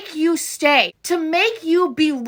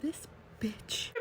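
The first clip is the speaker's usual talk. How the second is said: much softer, breathy whisper; whispered insult; 6 semitones lower, 20 dB quieter than usual. Real, not acted. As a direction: hiss the insult under your breath. Whispered insult after long pause.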